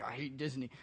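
sulky grumble. Retold or imitated. Soaked brother-in-law sulking.

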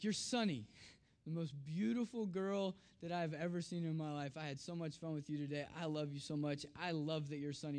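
low, soft fatherly tenderness; tender reassurance. Retold or imitated. Dad tucks in his daughter, tender and hushed.

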